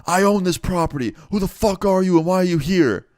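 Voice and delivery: high, loud, furious shout across the junkyard; a property owner hollering at trespassers; yelling out. Retold or imitated. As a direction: owner yelling at hidden intruders, angry shout. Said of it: "this guy yells out".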